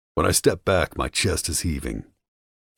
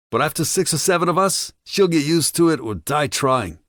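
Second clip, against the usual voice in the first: much higher, loud jeering thug; vicious captive taunt; 12 semitones higher, 4 dB louder than usual. A narrator's reading. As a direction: sneer every word to provoke him.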